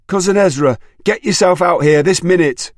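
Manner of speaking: high, loud furious yell; shouting. A narrator's reading. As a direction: bellow it across the yard. Furious mother calling out.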